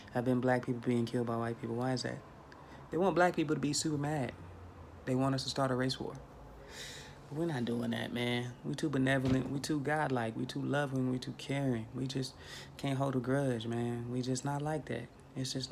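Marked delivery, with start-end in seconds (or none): none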